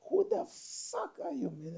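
squeaky high mock outrage; mock outraged yell. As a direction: throw the challenge up high. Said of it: "I'm like".

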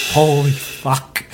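slow, drawn-out disbelief; stunned reaction. Retold or imitated. Driver waking to a smashed car, stunned. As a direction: stretch out each word as it sinks in. Retold shock on seeing the crash.

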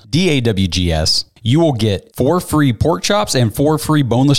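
upbeat sponsor read for steaks. Acted. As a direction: pitch the steaks like a salesman. Host reads sponsor ad.

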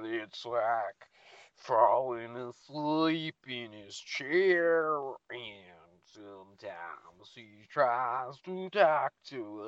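mocking Southern accent, slow and loud; Southern drawl. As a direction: stretch each word in an exaggerated Southern drawl. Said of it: "with your deep southern drawl".

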